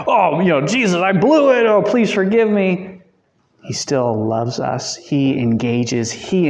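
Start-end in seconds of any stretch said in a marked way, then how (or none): 0.1-3.0 a hammed-up, higher pleading voice; lightly voiced penitent disciple begging forgiveness; mock disciple pleading. Teacher mocking the disciples: higher, hammed-up pleading. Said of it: "going like".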